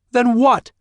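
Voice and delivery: very high, loud "Then what?"; loud demand. Acted. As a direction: demand an answer at once. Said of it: "she demanded".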